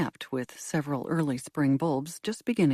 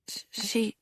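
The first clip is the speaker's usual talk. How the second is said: hesitant stammer; 6 semitones higher, 4 dB quieter than usual. A narrator's reading. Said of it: "the woman hesitated".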